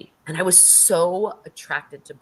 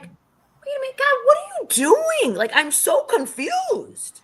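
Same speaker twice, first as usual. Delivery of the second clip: much higher, bewildered cry to God; high confused plea; 4 dB louder than usual. Retold or imitated. Asking God about the warning, high bewildered plea. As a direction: throw it up to God, lost and baffled. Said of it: "was like".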